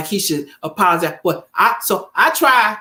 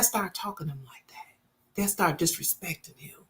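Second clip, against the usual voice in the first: voiceless whispered aside; 4 semitones lower, 14 dB quieter than usual. Real, not acted. Telling viewers about family disrespect, whispered. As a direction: whisper it like a secret.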